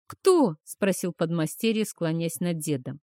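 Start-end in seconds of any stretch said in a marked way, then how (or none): 0.1-0.6 sharp, high startled question. A narrator's reading. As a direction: ask it in sudden alarm. Apprentice asks, startled.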